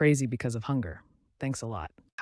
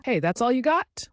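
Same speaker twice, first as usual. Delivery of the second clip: mocking taunt; 10 semitones higher than usual. Fighter taunting his opponent.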